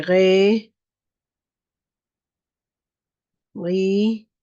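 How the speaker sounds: "Ga", "re", "ri", "Go", "gu" said loud and slowly, one at a time; syllable drill. Shown as a demonstration. Teacher drilling the sounds for the class, loud and slow. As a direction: call out each syllable clearly. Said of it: "representación fonética".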